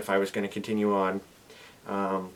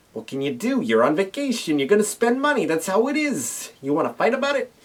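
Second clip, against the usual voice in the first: high sassy mock challenge; playfully defiant; 9 semitones higher than usual. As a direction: tease like picking a fight. Playful defiance about spending.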